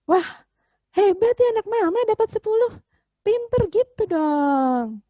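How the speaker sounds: high, loud, acted praise with drawn-out endings; hammed-up mom cheering her child; lavish the praise, stretching the last words; gushing proud mother. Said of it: "Mamahnya bilang".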